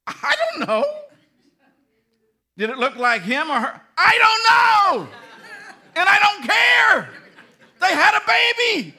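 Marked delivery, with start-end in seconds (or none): 0.1-0.8 high laughing answer; high giggly woman's answer; laughing reply. Acted. 4.0-7.1 high, loud comic shout; loud, high woman's yell; loud retort. Acted. 7.8-8.9 loud, high exasperated laughing shout; loud laughing fed-up answer. Acted. Man's exasperated reply, shouted.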